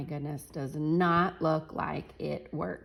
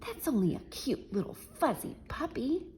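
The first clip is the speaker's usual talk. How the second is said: whisper; 7 semitones higher, 5 dB quieter than usual. Acted. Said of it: "whispered the other burglar".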